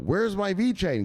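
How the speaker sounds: high, slow panicked squeal; mock panic. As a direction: play up his own freak-out. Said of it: "'Cause I was freaked out yesterday".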